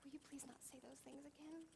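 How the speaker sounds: small whispered plea; child's whisper. Retold or imitated. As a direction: whisper it like a hurt little boy. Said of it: "and he goes".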